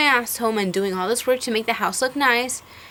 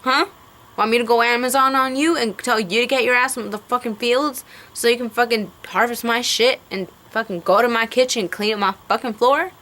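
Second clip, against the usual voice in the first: angry retort. Retold or imitated. To sexist men telling her to get in the kitchen, angry fast comeback. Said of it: "So it's like".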